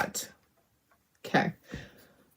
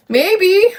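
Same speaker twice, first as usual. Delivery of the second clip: much higher, louder, playful squeak on "Maybe"; "Maybe" piped up high like a cartoon voice; squeaky high maybe; 11 semitones higher, 5 dB louder than usual. Real, not acted. Playful repeat of her own word.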